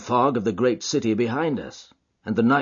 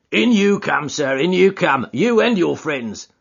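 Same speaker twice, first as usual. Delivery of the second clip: louder, hearty doorkeeper waving them in; welcoming porter; 8 semitones higher, 6 dB louder than usual. Acted. Porter letting everyone in, hearty.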